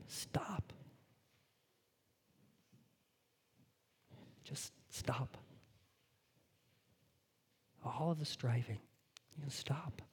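soft, hushed whisper; preacher whispering the call to rest; whispered sermon close. Real, not acted. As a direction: let it fall to a whisper. Closing whispered appeal.

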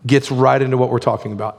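usual speaking voice

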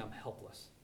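dropped to a near hush, helpless; quiet plea. Acted. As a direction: let it fall away to almost nothing. Voicing the father's plea.

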